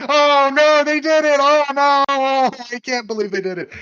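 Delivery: hammed-up wail of dismay, very high and loud; Paul Pogba. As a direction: cry out in mock horror, dragging the "no". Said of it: "Paul Pogba's probably somewhere in France being like".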